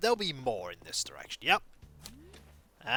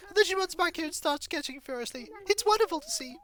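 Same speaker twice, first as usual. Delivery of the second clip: put-on much higher, fast, bubbly shop girl; cheery shopkeeper voice; faster than usual. Shopkeeper greeting customer, high bubbly chatter. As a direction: chirp it like an overeager cartoon shopkeeper.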